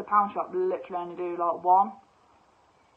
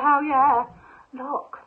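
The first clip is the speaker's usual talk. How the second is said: higher, louder cheer; hell yeah; 6 semitones higher, 7 dB louder, much slower than usual. Retold or imitated. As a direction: cheer at the find. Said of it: "And I was like".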